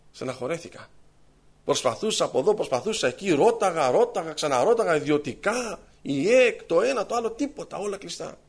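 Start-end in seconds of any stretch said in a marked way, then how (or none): none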